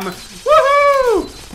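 high gleeful cheer; excited whoop. Real, not acted. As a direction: celebrate loudly. Cheering in game.

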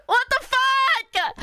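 high, drawn-out "What the fuck"; whining outrage. Pretend. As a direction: wail it slowly in mock despair. Outburst repeating the same words.

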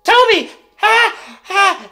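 loud, high burst after being stuck; explosive clown announcement; triumphant shout. Acted. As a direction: blurt the name out at full voice. Clown finally bursts out his name.

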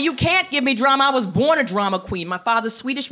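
usual speaking voice

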